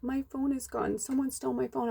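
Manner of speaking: mock upset voice, pitched high and small; retold tearful distress. Crone lady telling us her phone is gone, imitated tearful. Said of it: "comes up to us in tears".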